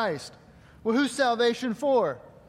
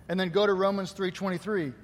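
ordinary delivery

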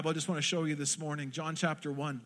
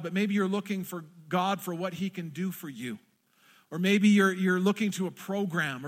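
normal talking voice

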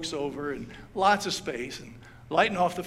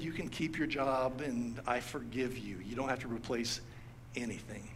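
softer, lower, gently forgiving; gentle forgiveness; 5 semitones lower, 8 dB quieter than usual. Retold or imitated. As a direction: let him off tenderly, almost hushed. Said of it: "showed mercy and took pity".

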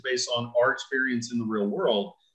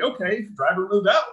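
lieutenant's order; 8 semitones higher than usual. Retold or imitated. Lieutenant on the radio orders his driver forward, a firm command. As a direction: command the driver to roll out, higher.

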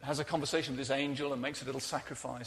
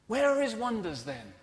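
higher, louder, demanding cry; Gideon's exasperated question; 6 semitones higher, 5 dB louder than usual. Acted. Gideon looks back and asks.

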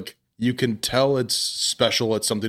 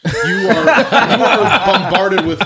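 louder, higher, slow laughing stress; laughing emphasis; 8 semitones higher, 9 dB louder, much slower than usual. Real, not acted. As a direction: laugh while leaning into it.

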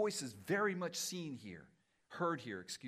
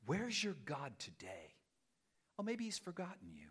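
acted hushed needling; insinuating inner voice; 7 dB quieter than usual. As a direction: quietly plant doubt in the listener's mind. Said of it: "we hear the voices saying".

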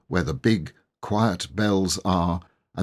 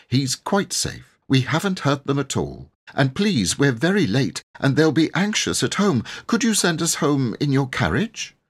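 put-on lightly higher boy's voice, polite; 7 semitones higher than usual. A narrator's reading. Robert calms the vicar's wife, a lightly voiced boy. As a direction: reassure her, then ask nicely.

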